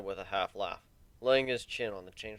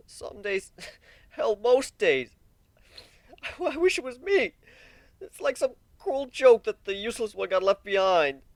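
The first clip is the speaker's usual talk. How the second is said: much higher and louder, bitter grief; anguished outburst; 12 semitones higher, 8 dB louder than usual. Acted. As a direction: cry out the unfairness. Warren bitter, sobbing outburst.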